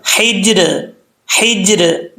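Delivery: "हिज्र" with an echoing bounce on j, no added a vowel; careful Arabic "हिज्र", j lightly rebounding; qalqala. Shown as a demonstration. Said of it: "कलकला करते हुए ज़बर नहीं पढ़नी".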